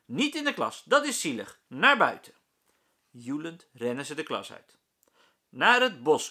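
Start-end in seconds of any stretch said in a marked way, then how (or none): 0.1-2.3 high, loud hurried orders; calling out. A narrator's reading. Meester Jaap shouts orders at the class, loud. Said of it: "roept meester Jaap". 5.5-6.3 loud command. A narrator's reading. Meester Jaap commands the class, loud. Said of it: "commandeert meester Jaap".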